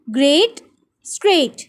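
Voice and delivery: ग्रेट rising, स्ट्रेट falling, same "ay" vowel; rhyming pronunciation. Shown as a demonstration. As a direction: rhyme ग्रेट with स्ट्रेट, spaced apart. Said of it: "इन सब की sounds different हैं". (ग्रेट, स्ट्रेट एक ही "ए" ध्वनि में)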